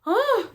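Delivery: sharp high gasp of pain. Retold or imitated. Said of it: "I go".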